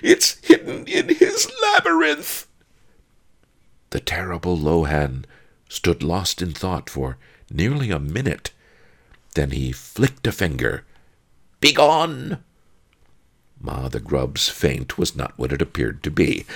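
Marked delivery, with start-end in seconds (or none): 0.0-2.5 very high, loud shrieking confession; terrified scream. A narrator's reading. Pawnbroker confessing the hiding place to the spectre, screamed. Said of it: "screamed Pawnbroker Fang". 11.6-12.4 loud, high ghostly dismissal; spectre's command. A narrator's reading. Spectre dismissing grave robbers with flick of finger, commanding.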